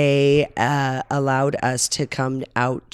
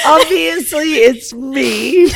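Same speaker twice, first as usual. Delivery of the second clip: high-pitched laughing words; real laughter; 12 semitones higher, 5 dB louder, slower than usual. Real, not acted. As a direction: still cracking up while talking. Laughing fit during podcast.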